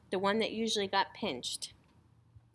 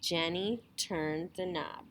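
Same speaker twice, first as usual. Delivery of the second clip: ordinary delivery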